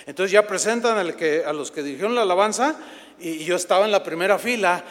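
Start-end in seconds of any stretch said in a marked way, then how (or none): none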